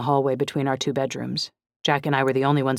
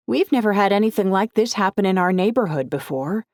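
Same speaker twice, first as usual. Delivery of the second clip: higher, louder news-clip neighbor; community woman; 6 semitones higher, 5 dB louder than usual. Acted. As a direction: speak as a worried local on TV.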